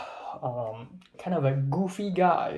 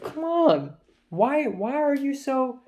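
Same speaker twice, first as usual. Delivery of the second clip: pitched way up, pleading and exasperated; exasperated plea; 10 semitones higher, slower than usual. Retold or imitated. Retelling pleading with Thomas, high exasperated whine. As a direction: whine it at him like he's hopeless.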